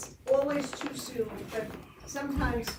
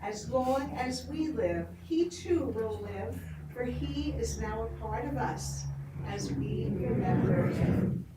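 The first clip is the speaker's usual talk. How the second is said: memorial reading; 2 semitones lower than usual. Acted. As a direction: read it like a litany at a memorial.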